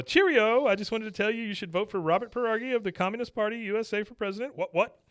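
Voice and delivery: high, cheery British puppet voice; penguin puppet voice. Retold or imitated. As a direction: chirp it up high like a posh puppet. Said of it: "He goes".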